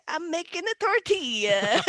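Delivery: exaggerated Italian lilt, slow and high; hammed-up Italian, poking fun at a husband; mock Italian accent. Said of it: "every accent he does is Italian".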